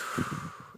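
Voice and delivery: audible blown-out breath; demonstrated breath out. Retold or imitated. Storyteller showing how he breathed on his hands, blown-out breath. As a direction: blow out a breath to show it. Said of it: "I breathed".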